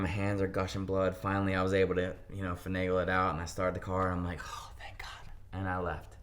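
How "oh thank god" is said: breathy whispered relief; relieved whisper. Retold or imitated. Runaway teen starting the stolen car, whispered relief. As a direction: whisper it like the danger just passed.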